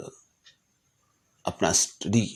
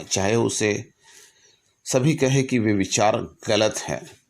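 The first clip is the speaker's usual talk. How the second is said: raised, earnest recitation of a letter; formal letter reading; 3 semitones higher, 3 dB louder than usual. Acted. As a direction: read it like a solemn public address. Reads Lincoln's letter aloud. (गंभीर भाव से पत्र का पाठ)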